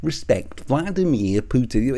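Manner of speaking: slow, put-on measured voice; slow mocking impression; Mark Collette impression. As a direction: imitate a ponderous earnest speaker, slowly. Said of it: "how does he speak?".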